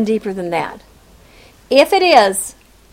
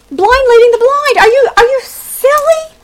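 mocking incredulity; 10 semitones higher, 5 dB louder than usual. Mocking the blind prophets.

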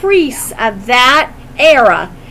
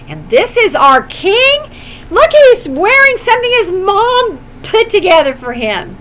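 higher, louder sneer at a poorly dressed king; incredulous, mocking tone, pitched up and loud; mocking scorn; 7 semitones higher, 5 dB louder than usual. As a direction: scoff at him like a snobbish priest. Priests mocking poor Jesus.

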